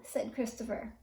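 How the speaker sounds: usual speaking voice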